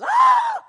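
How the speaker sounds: loud startled "Ah!" cry; shocked gasp. Acted. Woman shocked by Jesus.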